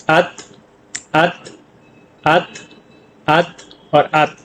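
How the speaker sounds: अत को धीमे बोलें, त को बिना छोड़े बंद करें; हर अत को अलग-अलग दोहराकर दिखाएँ; ते की अंतिम ध्वनि. Shown as a demonstration. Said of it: "इसको बोला जाएगा अत". (अत, अत और अत को धीमे-धीमे दोहराएँ)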